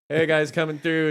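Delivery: retold heads-up call. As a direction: announce yourself coming through with a load. Calling out while pushing loaded cart.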